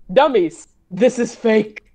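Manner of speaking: ordinary talk, nothing marked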